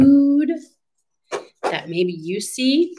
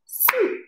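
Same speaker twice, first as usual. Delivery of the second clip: "Soup" as one short, high beat; single clapped syllable; 6 dB quieter than usual. Shown as a demonstration. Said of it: "How many syllables are in the word".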